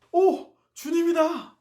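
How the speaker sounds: high, slow cry of sudden recognition; shocked joyful shout from the boat; surprised cry. Retold or imitated. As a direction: call out in amazement, high and drawn out. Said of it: "깜짝 놀라서".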